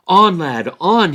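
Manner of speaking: loud, high urging of the pony; crying out. Acted. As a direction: spur the pony on with a shout. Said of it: "he cried".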